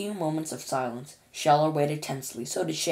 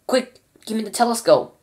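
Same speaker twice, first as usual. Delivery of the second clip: urgent yell, higher and louder; 7 semitones higher, 7 dB louder than usual. Acted. Shuckworth yelling for the telescope in alarm. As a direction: bark the order for the telescope. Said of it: "yelled Shuckworth".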